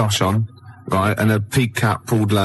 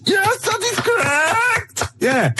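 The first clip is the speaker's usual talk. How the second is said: put-on high, slow, squealing German accent; movie villain German accent; slower than usual. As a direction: shriek it like a hammy Nazi actress. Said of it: "you an actress?".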